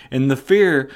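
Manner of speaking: usual speaking voice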